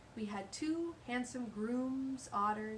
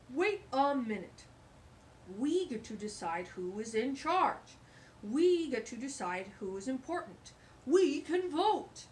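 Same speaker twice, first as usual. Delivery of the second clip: louder, eager dog voice, slowing right down on "We can vote"; rally the animals like a smart dog with a plan; clever dog voice; 3 semitones higher, 6 dB louder than usual. Acted. Mister Paws telling the animals they can vote, louder and eager.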